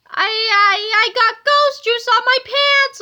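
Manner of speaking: high, loud, slow blubbering wail; tearful wail. A narrator's reading. Loogie wails to the thugs about his pants, blubbering. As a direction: burst into tears over the pants. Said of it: "Loogie wailed as he burst into tears".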